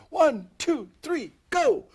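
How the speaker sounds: high, loud crowd count; audience yell. Retold or imitated. Audience counting along at the magic show, yelling together. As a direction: shout it out with the whole room. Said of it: "have the whole audience yell out".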